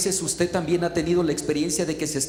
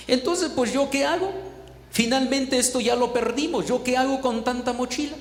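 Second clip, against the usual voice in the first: higher-pitched, helpless questioning; retold exasperation; 6 semitones higher than usual. Maquila owner stuck with backpacks, retold exasperation. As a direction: voice someone at a loss, pitch raised.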